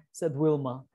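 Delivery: usual speaking voice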